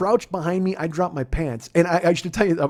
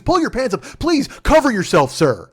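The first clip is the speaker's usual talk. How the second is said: higher and louder, prim alarm; flustered pleading; 7 semitones higher, 6 dB louder than usual. Retold or imitated. Agent begging him to cover up, prim and flustered. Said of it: "he goes".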